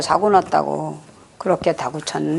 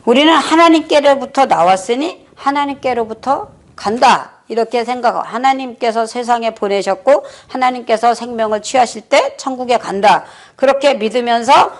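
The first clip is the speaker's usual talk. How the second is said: higher, raised preaching voice; 5 semitones higher than usual. Acted. Preacher urging the congregation toward obedience, fervent preaching. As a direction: lift it into a fervent sermon climax.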